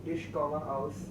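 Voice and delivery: usual speaking voice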